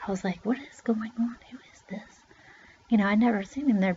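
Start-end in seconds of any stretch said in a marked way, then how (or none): 0.4-2.2 barely audible whisper, retold; whispered bewilderment. Hidden child wondering about a stranger, hushed whisper. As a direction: whisper it to yourself, puzzled.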